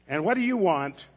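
a busy pharmacist snapping at an interruption; irritated pharmacist brushing off a child; annoyed. A narrator's reading. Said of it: "in an annoyed tone of voice".

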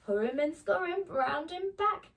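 high, loud scurrying chant; excited refrain. A narrator's reading. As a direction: chant it like a frantic little scamper.

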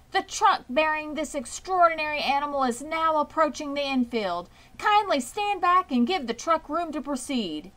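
grand loudspeaker voice; fair announcer. A narrator's reading. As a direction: boom it out to the whole fairground.

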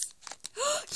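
sharp, high gasp of delight; excited gasp. Real, not acted. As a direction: gasp at a wonderful surprise. Gasp on opening second pack.

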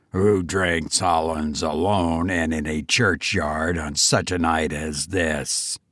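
very low menacing goblin. A narrator's reading. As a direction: growl the question low. Goblin voiced in story.

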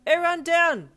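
high, loud warning; shout. A narrator's reading. Shoji warning his team of incoming snowballs, shouting. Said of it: "you heard Shoji shout".

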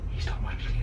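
whispered, no voice at all. Real, not acted. Streamer hiding from the neighbour, whispering. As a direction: breathe "He stopped watching T V" in a hushed whisper. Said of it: "repeated as a whisper".

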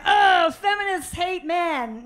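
loud, drawn-out mock complaint. Guys griping about feminists, mock complaint. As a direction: whine it slowly like an exasperated heckler.

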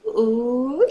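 loud, rising ooh; eager, drawn-out exclamation; excited ooh. A narrator's reading. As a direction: swoop up in admiration. Friend spots a cute guy.

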